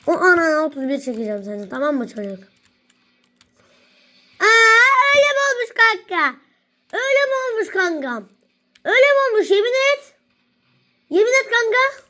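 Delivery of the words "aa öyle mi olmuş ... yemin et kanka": high-pitched put-on astonishment; mock amazement. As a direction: act hugely impressed, pitched well up. Mock surprise at a friend. (tiz sesle yapmacık şaşkınlık)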